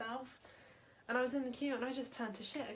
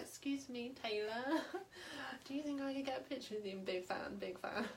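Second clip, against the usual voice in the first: lightly imitated small child, soft and a little higher; timid little-kid request, mildly put on; five-year-old child; 4 semitones higher, 4 dB quieter than usual. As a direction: ask softly like a starstruck five-year-old. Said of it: "like a five-year-old child".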